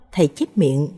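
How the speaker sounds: usual speaking voice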